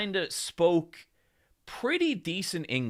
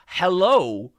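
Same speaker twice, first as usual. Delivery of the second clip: louder, higher mock-cheerful hello; blurted greeting; 4 semitones higher, 10 dB louder than usual. Tourist thanking for coffee with a louder cheery hello. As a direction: pop it out brightly at the barista. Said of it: "I would go".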